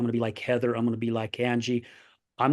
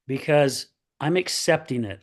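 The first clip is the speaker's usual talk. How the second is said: normal talking voice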